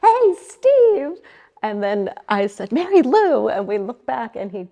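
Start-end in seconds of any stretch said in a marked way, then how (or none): 0.0-1.2 high, loud mock scream of a name; imitated scream. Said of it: "Mary Lou screams his name". 2.7-3.5 loud, high-pitched reproachful name call; retold exclamation. Retold startled scolding of friend.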